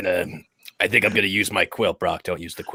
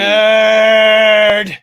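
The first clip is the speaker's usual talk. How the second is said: long, high shout; mock heckle; 12 semitones higher, 9 dB louder than usual. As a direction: holler it high and stretch the vowel right out. Heckles the talk about D and D.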